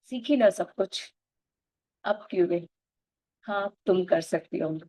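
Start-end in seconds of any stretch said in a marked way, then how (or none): none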